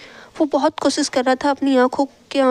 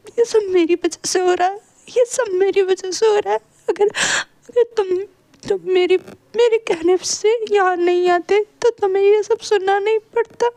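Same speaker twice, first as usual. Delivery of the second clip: much higher, slow, broken by sobs; crying; 7 semitones higher than usual. A narrator's reading. Sister apologizes to her brother, crying hard. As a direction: weep the apology out, barely holding together. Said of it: "Yanli भी रोते हुए कहती है".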